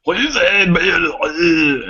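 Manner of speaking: mock-angry foreign gabble, high and drawn-out; imitated angry chef. Customer retelling the Danish chef's reply, mock angry gabble. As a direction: imitate an irate chef's long unintelligible outburst. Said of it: "They sounded really angry with you".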